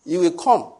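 preacher exclaiming to the congregation; high exclamation. Real, not acted. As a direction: leap up in pitch for effect.

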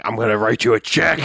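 mock yell at a caterer; half-volume mock bellow; retold yelling. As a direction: yell it in a put-on voice, held back. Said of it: "he's yelling at the caterer".

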